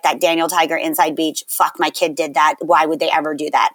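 low, soft weary mom grumble; a tired mom venting; exasperated mom. Retold or imitated. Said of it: "moms where they're like".

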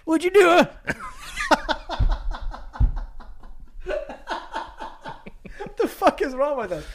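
high laughing voice. Real, not acted. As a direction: laugh through the words. Laughing at the bit.

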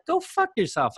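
high-pitched mock retort; retold retort. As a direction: quote the manager's dismissal high, without extra volume. Manager's retort to angry emailer retold.

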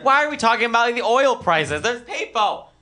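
high, fast exasperated plea. Retold or imitated. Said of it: "I was like".

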